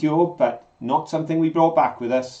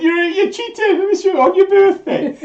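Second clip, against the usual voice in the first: very high-pitched put-on outrage; squeaky play-acted telling-off of mum; mock accusation; 5 dB louder than usual. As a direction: imitate an exasperated son, voice shot way up. Acts out son accusing his mother.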